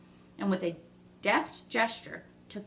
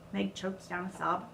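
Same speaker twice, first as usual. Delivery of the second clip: plain reading voice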